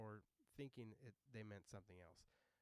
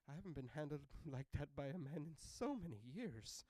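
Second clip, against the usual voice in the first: higher, louder swooning voice; 5 semitones higher, 7 dB louder than usual. Retold or imitated. Elderly lady to man who caught her, swooning. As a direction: savor it like a thrilled old lady. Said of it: "it was an elderly lady".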